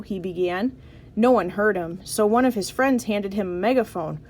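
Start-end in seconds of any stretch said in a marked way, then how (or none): none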